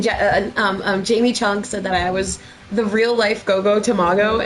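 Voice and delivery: ordinary delivery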